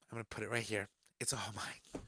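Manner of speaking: whispered aside. Real, not acted. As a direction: whisper it possessively. Hoarding the mask.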